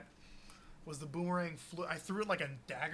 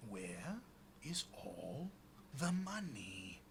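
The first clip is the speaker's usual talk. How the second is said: low, soft, word-by-word threat; slow menace; 4 semitones lower, 7 dB quieter, much slower than usual. Acted. Rogue repeats demand to a scared kobold, slow menace. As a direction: space out each word menacingly.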